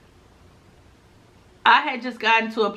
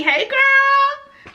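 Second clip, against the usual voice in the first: much higher, louder, drawn-out hello; excited greeting; 4 dB louder than usual. Real, not acted. Vlogger greeting a fan's comment, excited.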